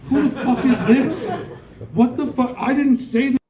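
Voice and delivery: high, loud, laughing outburst of disbelief; outraged disbelief. Retold or imitated. Retelling a TikTok live, he shouts high-pitched disbelief. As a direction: yelp it out, half laughing at the absurdity.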